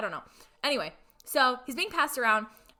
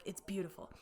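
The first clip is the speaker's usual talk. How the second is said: much softer, lower, almost breathed out in awe; hushed praise; 3 semitones lower, 13 dB quieter than usual. Retold or imitated. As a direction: say it softly, moved by what you read. Wife praises his writing, retold.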